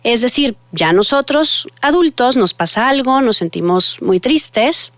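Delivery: ordinary delivery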